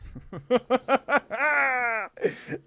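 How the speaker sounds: long burst of laughter. Real, not acted. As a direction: crack up at your own rant. Cracks up after own rant.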